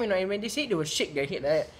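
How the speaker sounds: normal talking voice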